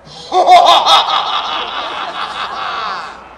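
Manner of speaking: maniacal evil cackle; mad scientist laugh. Shown as a demonstration. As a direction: cackle like a villain plotting. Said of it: "you're gonna laugh like a mad scientist like this".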